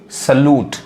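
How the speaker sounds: सैल्यूट as 'suh-LOOT', no y-glide; taught pronunciation. Shown as a demonstration. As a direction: say सैल्यूट with a plain 'loo'. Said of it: "लू sound यानी ऊ sound". (सैल्यूट को 'सलूट' बोलें)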